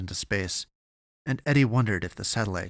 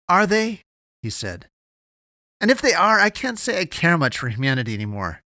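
loud, high-pitched challenge, easing slightly by the end; heated, raised-voice dismissal; defiant retort; 9 semitones higher, 8 dB louder than usual. A narrator's reading. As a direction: throw it back in defiance. Defiant retort in narrated quarrel.

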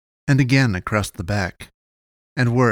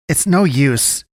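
higher-pitched, firm cut-in; interrupting; 5 semitones higher, 4 dB louder, slower than usual. A narrator's reading. Dying man breaking up the boys' argument, interrupting. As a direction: cut in firmly over their argument. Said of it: "the man interrupted".

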